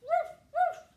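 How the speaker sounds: high, loud puppy woofs; two sharp, high-pitched dog barks. A narrator's reading. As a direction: bark like an excited little puppy. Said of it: "her puppy said".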